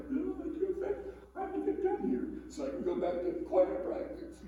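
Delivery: high, eager voice of a church-going delivery man; keen to get back to choir; delivery man's voice. Retold or imitated.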